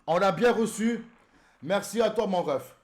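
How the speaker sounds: loud, high-pitched thanks; emphatic thanks. Real, not acted. Streamer confirming receipt to a friend, excited. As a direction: call your thanks out high.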